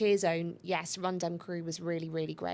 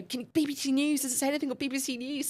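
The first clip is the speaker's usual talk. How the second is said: high urgent plea; 8 semitones higher than usual. Retold or imitated. Her own plea mid-race.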